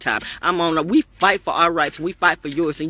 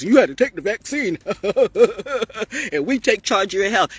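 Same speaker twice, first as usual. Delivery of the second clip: higher, lightly mocking officials' voice with a laugh; mocking quote; 4 semitones higher than usual. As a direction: poke fun at their promise. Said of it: "mocking the officials' words".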